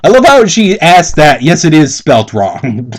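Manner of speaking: ordinary talk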